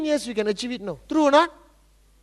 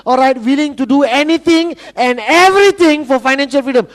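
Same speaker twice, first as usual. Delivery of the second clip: rousing motivational build; 3 semitones higher, 7 dB louder than usual. Real, not acted. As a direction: hammer each item, growing louder and higher. Speaker building a rousing motivational list.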